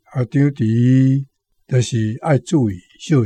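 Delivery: normal talking voice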